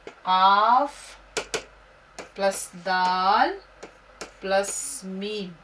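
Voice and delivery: slow spelling. Shown as a demonstration. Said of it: "देखिए उसके बाद कदम".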